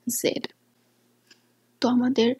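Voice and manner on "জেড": জেড with a light buzzing z; soft correct z. Shown as a demonstration. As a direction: let the z slip out softly. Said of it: "এটা হবে".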